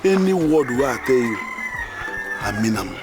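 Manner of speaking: tender pleading. Acted. Pleading love confession.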